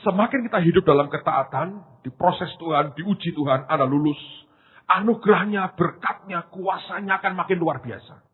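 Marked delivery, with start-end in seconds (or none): none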